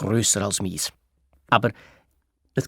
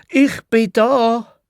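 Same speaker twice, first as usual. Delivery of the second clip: hoch, laut und langsam gerufen; 10 semitones higher, 5 dB louder, much slower than usual. Acted. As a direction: gedehnt aus dem WC rufen. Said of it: "hat Clara gerufen". (lauter gedehnter Ruf)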